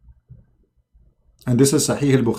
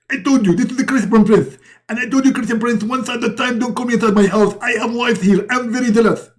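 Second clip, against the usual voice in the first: high, hammed-up indignant rant; jealous husband in a high put-on voice; Muhammad; 9 semitones higher, faster than usual. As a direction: rattle off a comic telling-off, pitched high. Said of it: "and Muhammad, he answer".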